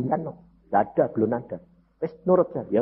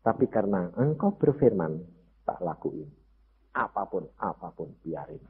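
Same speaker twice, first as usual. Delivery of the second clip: low, soft retold plea to God; understated, sinking lament; mild tearful prayer; 7 semitones lower, 6 dB quieter than usual. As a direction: pray quietly, a touch of weeping. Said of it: "baca Alkitab sampai nangis".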